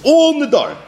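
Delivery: All punched high above the rest, Nedarim falling away; stress on All. Shown as a demonstration. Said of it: "Emphasis on the word Kol".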